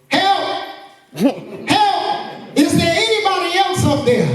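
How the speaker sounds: high, desperate shout for help; yelling for help. A narrator's reading. Jack yells up the cliff for anyone else, a high desperate shout. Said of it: "Jack yelled".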